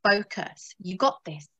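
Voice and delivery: quiet, reassuring inner voice. Retold or imitated. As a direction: soothe yourself into focus. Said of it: "my higher mind is saying".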